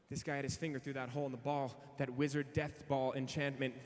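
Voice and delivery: ordinary talk, nothing marked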